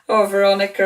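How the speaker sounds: ordinary talk, nothing marked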